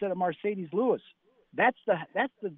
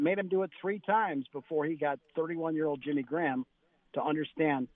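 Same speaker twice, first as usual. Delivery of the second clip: ordinary talk, nothing marked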